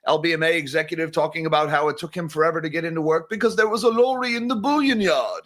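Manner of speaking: put-on British accent as a rushed joke; bad British accent. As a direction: do a quick, cheesy British accent impression. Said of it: "that's my British accent".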